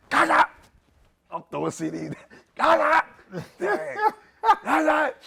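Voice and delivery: high, loud yelps of disgust; hammed-up furious father; mock outraged yell. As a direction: bark the name out as you smash records. Dad smashing CDs, yelling.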